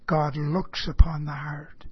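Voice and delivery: "God looks upon the heart." in a breathy whisper, "God" leaned on. Shown as a demonstration. The preacher whispering the key truth to his listeners. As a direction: let the truth hush to a whisper. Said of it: "keep one thing in mind".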